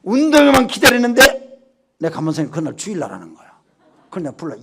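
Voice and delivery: ordinary talk, nothing marked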